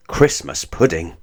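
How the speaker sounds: usual speaking voice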